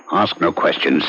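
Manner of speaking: ordinary delivery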